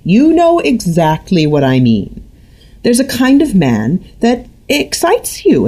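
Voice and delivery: high, needling mother pushing her point; prying mother. A narrator's reading. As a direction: corner her daughter. Mother keeps pressing daughter.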